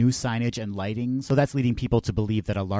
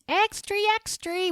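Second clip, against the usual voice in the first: put-on very high-pitched little kid hawking papers; high squeaky paperboy call; newsboy kid. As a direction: cry it out like a young newsboy. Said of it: "little kid going".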